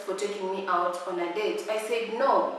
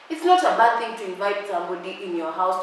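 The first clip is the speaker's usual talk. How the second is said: ordinary talk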